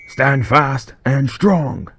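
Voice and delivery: loud, slow, high-pitched battle yell; sergeant's yell. Acted. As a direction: drag out a commanding roar before the charge. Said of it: "the sergeant yells".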